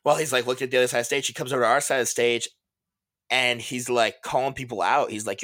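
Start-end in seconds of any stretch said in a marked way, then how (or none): none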